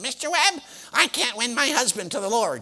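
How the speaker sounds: high fretful church lady voice; woman's complaining tone. Retold or imitated. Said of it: "he mimicked her tone of voice".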